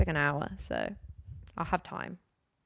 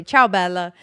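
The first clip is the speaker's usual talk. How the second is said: louder, a light Italian lilt on Ciao, Bella; Italian greeting; 9 dB louder than usual. Retold or imitated. Quoting the tiramisu man's texts, a light mock Italian accent. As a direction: greet warmly like an Italian café owner. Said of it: "he'll always say".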